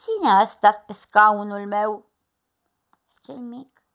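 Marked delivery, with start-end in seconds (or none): 0.0-2.1 slow, loud bear voice; mama bear asking slowly; middle bear. A narrator's reading. Middle bear echoes question.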